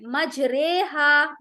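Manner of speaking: मजरेहा drawn out very slowly, रे tilted toward e; slow, careful मजरेहा with the ri bent to re; correct recitation. Shown as a demonstration. Teacher modelling मजरेहा after rejecting मजरी. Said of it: "इसे हमें इस तरह से करना है".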